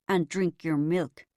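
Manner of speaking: slow cowboy drawl. Retold or imitated. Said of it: "Dad drawled".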